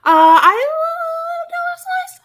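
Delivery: very high, loud, slow squeal of delight; excited squeal. Real, not acted. Cohost gushing to her friend about dollar pizza, delighted squeal.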